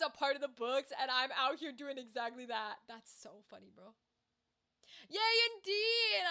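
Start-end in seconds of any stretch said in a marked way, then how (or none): 5.1-6.2 high, loud, drawn-out cheer; thrilled, stretched-out celebration; excited cheer. Real, not acted. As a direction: cheer it slowly with real delight. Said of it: "I'm so excited".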